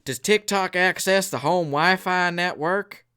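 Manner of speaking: slow, loud, high-pitched interrogation; mock congressman. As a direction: imitate a congressman grilling a tech boss, word by word. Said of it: "He's like".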